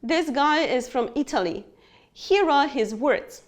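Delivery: ordinary talk, nothing marked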